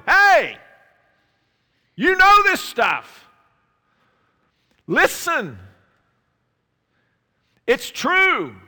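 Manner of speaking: very high, very loud proclaiming shout; bold as a lion. Acted. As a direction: cry it out boldly like Peter. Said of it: "bold as a lion".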